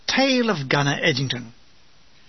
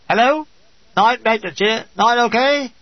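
raised, higher voice checking the phone line; loud call down a field telephone; 5 semitones higher, 4 dB louder than usual. Acted. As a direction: call out to be heard on the wire. Signaller calling over phone line.

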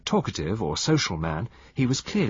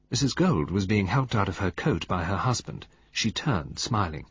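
plain reading voice

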